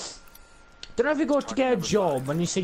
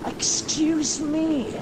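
much higher and slow, drawn out indignantly; mock offended; 9 semitones higher, much slower than usual. Mocking a woman told she is only six, in a slow, much higher scandalised tone. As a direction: stretch it like a scandalised diva.

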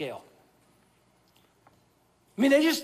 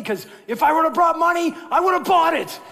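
high, loud excuse breaking into a laugh; imitating the friend with a laugh; mock excuse; 9 semitones higher, 7 dB louder than usual.

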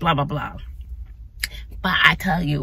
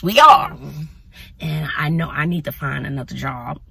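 ordinary talk

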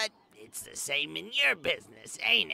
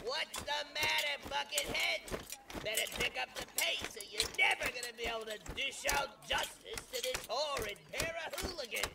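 mocking taunt; 8 semitones higher, 6 dB quieter, faster than usual. Mocking taunt while fleeing.

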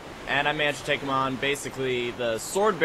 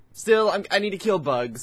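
normal talking voice

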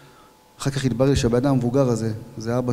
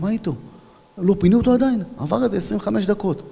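urgent questioning; 8 semitones higher than usual. Retold or imitated. Urgent exchange over wounded man.

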